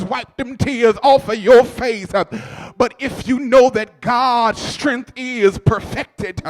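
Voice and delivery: loud, ringing sermon climax; rising gospel preaching with music under it; full-force preaching. Acted. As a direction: preach it out to the whole church.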